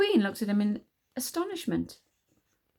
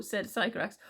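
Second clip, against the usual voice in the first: plain reading voice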